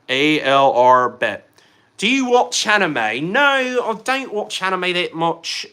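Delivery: slow, hammed-up British accent; butchered British accent. Streamer trying to throw chat off, mock British accent. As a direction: put on a badly faked British accent, drawn out. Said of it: "I'm so butchering this accent".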